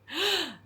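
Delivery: sharp high gasp of delight; high shocked gasp. Retold or imitated. Reacting to the book's big line, a high gasp. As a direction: gasp like you can't believe it. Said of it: "And I was like".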